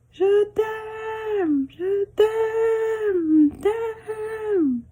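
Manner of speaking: high repeats fading away; echo. Acted. Mountains echoing back her love. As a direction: let it drift back from far off. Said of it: "echo of her words".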